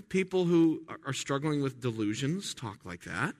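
normal talking voice

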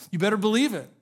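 usual speaking voice

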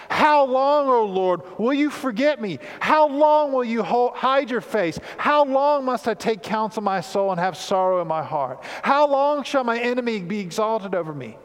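a lament cry that keeps growing; crescendo of how longs. Shown as a demonstration. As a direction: build the cry with every repeat. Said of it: "Four times, "how long" is repeated".

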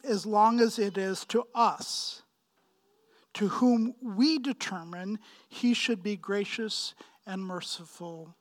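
normal talking voice

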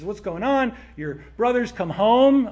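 loud, eager news shouted across the yard; excited call-out. Acted. Servant calling out the brother's homecoming loudly.

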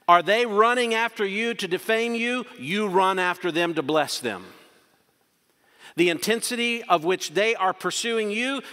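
preacher at full force; forceful preaching. Acted. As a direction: drive the contrast home from the pulpit.

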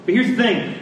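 ordinary talk, nothing marked

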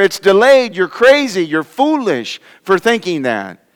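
high-pitched, insistent mockery of believers; scoffing. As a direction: jeer like an exasperated scoffer. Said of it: "scoffers will come in the last days with scoffing".

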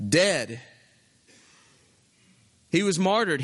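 usual speaking voice

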